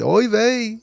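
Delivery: put-on high-pitched Yiddish accent. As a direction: whine it like an old Yiddish man. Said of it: "and he said".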